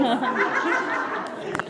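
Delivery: uproarious burst of laughter; helpless, very loud laugh. Real, not acted. Teacher laughs at ball in face, loud laughing.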